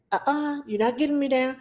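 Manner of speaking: mock toddler protest, pitched a touch up; imitating a stubborn baby. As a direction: play the baby refusing to be laid down. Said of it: "they're like".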